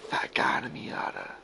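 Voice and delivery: whispered echo. Real, not acted. Host whispering back the cohost's joke.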